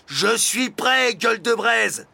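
loud, high, drawn-out challenge; defiant retort. A narrator's reading. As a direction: fire back boldly at the dragon. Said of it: "rétorqua le postier". (riposte bravache, haute et forte)

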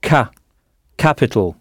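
"ka, capital", first syllable isolated, then stressed in the word. Shown as a demonstration. Teacher drilling French learners on English capital, stress on "ca". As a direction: hit the "ca" of "capital", let the rest drop. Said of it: "En insistant bien sur la première syllabe".

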